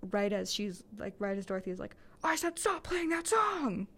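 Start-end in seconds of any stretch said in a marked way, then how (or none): 2.2-3.9 slow, high, roaring demon shout; angry demon yell. Retold or imitated. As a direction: yell it like a monster breaking out. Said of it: "she turns into, like, the scary demon".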